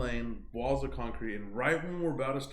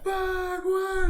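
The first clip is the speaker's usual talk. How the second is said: very high, loud panicked cry; reenacted shriek of alarm; mock panic; 6 dB louder than usual. Friends panicking as the tire goes, high mock-panicked shout.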